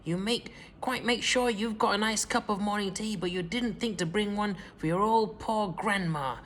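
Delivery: gloating cackle. A narrator's reading. Grandma catching George with the cup, high gloating cry. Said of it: "she cried".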